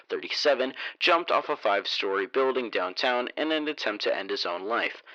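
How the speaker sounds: measured newspaper-article delivery; news report read. A narrator's reading.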